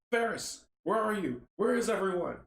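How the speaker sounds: high-pitched, urgent calling; crying out. Acted. Samuel searches for his crew, crying out. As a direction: call out for missing people. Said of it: "Samuel cries".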